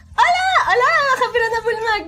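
high, loud excited hello; saludo efusivo. Real, not acted. Greeting a viewer joining the stream. (saludo entusiasta, agudo y fuerte)

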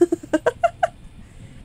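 high, rising mock villain cackle; rising sinister giggle; evil cackle. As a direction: cackle like a creepy master. Villain laugh for alien.

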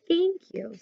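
high, soft little voice; tiny, quiet thanks; small grateful mole. A narrator's reading. Little Mole thanks Hedgehog softly.

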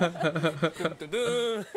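loud K-drama dun dun dun; dramatic sound effect. Acted. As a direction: sing the dramatic sting. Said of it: "that sound effect in there".

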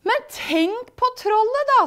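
very high, eager coaxing; enticing excitement. Acted. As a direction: tempt them with something exciting. Big goat tempts brothers.

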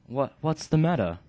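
loud, hesitant British shaman; British mystic, a little sheepish; British accent. Acted. Canius explaining his magic, British accent. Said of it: "Canius is gonna have the British accent".

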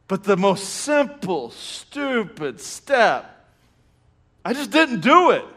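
slow, high, drawn-out comic frustration; exasperated self-reproach. Retold or imitated. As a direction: stretch each word, mock fed up with yourself. Comic frustration at his own mistake.

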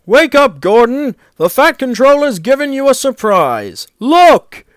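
loud, high-pitched rousing call; eager shouting to wake someone; excited. A narrator's reading. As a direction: burst in with thrilling news. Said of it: "Gordon's driver ran back excited".

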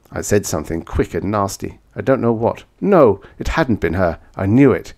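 usual speaking voice

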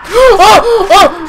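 high loud panicked yells; real terrified shout; scared scream. Real, not acted. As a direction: scream in sudden fright.

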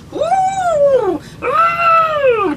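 high trumpeting laugh and loud whoops; big loud elephant whoops; elephant laughter. Pretend. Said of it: "Let's do elephant laughter".

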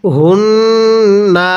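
হুন্না with a nasal ghunnah on ন্ন and a short final vowel; ghunnah, no stretch. Shown as a demonstration. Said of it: "গুন্নাহ করব তবে টান দিব না".

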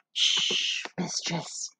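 soft, slow whispered soothing; hushing whisper. A narrator's reading. Young girl hushing her frightened mistress, whispered. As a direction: hush her gently, barely voiced.

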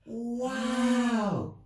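high amazed gasp of a word; awed wow. Acted. Shepherds amazed by the angel's news. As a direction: let the wonder escape you. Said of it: "whispered Ben and Nat again".

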